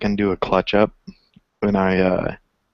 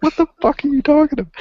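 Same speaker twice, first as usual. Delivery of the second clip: much higher, louder indignant yell; exasperated outburst; 4 dB louder, faster than usual. Retold or imitated. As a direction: let the frustration burst out. Going off on kid in parking lot.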